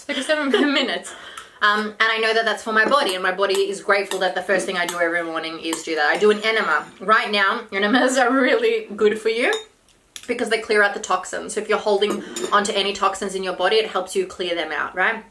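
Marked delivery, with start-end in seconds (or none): none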